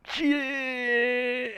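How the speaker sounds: long, high-pitched drawn-out cry; mock panic. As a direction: stretch the word in exaggerated dismay. Acts out reaction to crash.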